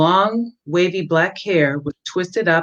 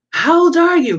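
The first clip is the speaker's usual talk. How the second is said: much higher and louder, startled; shocked question; 12 semitones higher, 5 dB louder than usual. A narrator's reading. Philip learns the girl is young, startled and much higher. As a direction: blurt it out in disbelief.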